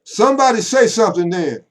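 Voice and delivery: loud, high call for a response; preacher's call. Pretend. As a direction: rouse the congregation with a shout. Preacher demanding congregation response.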